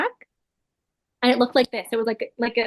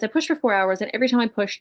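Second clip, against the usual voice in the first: ordinary talk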